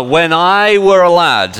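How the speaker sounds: high, loud, drawn-out mock Yorkshire; Yorkshire accent. Shown as a demonstration. Preacher showing his wife's home accent, loud Yorkshire imitation. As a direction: belt it out in broad Yorkshire. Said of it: "and it's a Yorkshire accent".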